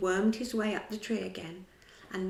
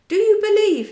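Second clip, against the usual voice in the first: much higher, very loud call; loud cry out; 13 semitones higher, 16 dB louder than usual. A narrator's reading. Peter calls out to the children of the world, loud and high. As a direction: call out to every sleeping child. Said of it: "he cried out".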